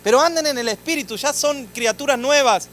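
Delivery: fervent scripture reading; scripture proclaimed. Acted. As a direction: thunder the Bible verse over the congregation. Paraphrases the verse, preaching.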